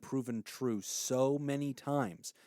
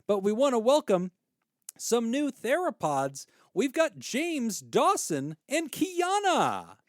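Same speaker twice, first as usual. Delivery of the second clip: excited; 11 semitones higher, 5 dB louder, slower than usual. Real, not acted. Welcoming new patrons excitedly.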